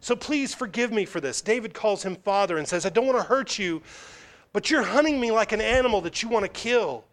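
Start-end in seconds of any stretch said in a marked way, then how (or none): 0.0-1.4 pleading David. Acted. Preacher voices David pleading to Saul. 2.8-7.0 David insisting to Saul he is harmless, pleading high. Acted. Preacher voices David's humble plea.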